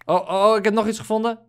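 high, loud burst of discovery; excited. Real, not acted. Gamer shouting to his friend about a new find. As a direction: blurt it out thrilled.

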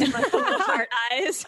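high, loud, giggling delight; laughing gush. Real, not acted. Friend gushing to the bride about his text, laughing. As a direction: swoon through a laugh.

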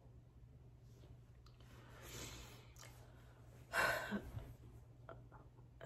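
long weary sigh; heavy sigh. Real, not acted. Alone on camera, a long weary sigh. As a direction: let out a long exhausted breath.

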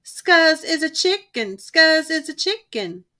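high, slow singsong taunt. A narrator's reading. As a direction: chant it to mock him. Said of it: "taunted the gang".

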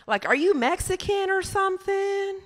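high mimicked questioning voice; restaurant lady. Lady asks comic about background, high mimicked voice. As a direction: ask it high, as the nosy lady. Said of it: "she was like".

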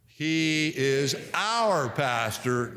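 "He is our pastor" slow, with "our" given weight; slow stress on our. Shown as a demonstration. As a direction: draw it out and lean on "our". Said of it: "Number two, "our".

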